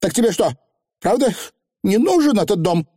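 high-pitched, incredulous question; incredulous young witch; disbelieving. A narrator's reading. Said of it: "недоверчиво спросила анаграмма".

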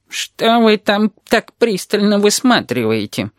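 slow, high-pitched testy question; irritated inquiry. A narrator's reading. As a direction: ask with drawn-out, needling annoyance. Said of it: "раздражённо осведомился Гомер у душеньки". (раздражённый, язвительный вопрос)

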